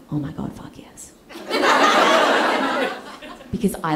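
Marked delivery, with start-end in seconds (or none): 0.1-1.1 low, near-whispered thrill; soft, reverent yes; hushed delight. Retold or imitated. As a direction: savor it under the breath. Awed reply to fondue news.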